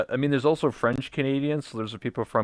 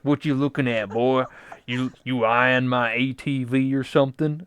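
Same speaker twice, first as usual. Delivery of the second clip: Southern hillbilly; 2 semitones higher, 4 dB louder than usual. Retold or imitated. Local confronting a city visitor, mock Southern hillbilly accent. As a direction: do a confrontational American Southern twang. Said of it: "from the South, from America".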